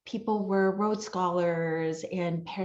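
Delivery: ordinary talk, nothing marked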